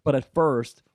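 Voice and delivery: usual speaking voice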